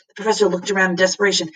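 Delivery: plain reading voice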